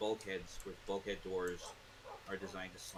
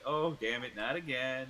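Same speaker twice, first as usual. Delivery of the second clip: louder, staged-sounding exasperation; scripted exasperation; 4 semitones higher, 7 dB louder than usual. Shown as a demonstration. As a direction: deliver it like a rehearsed tour line. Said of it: "you'd think he said it, like, scripted".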